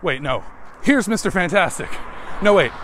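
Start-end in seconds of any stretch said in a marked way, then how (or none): none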